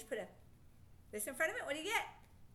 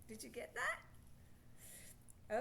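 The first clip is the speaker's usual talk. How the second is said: ordinary talk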